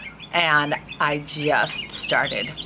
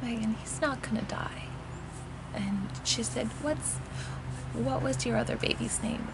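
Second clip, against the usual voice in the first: gentle midwife; 8 semitones higher, 9 dB quieter than usual. Retold or imitated. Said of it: "she's like".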